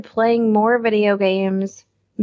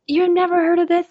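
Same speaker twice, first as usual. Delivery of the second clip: incredulous; 8 semitones higher than usual. Retold or imitated. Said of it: "And they're like".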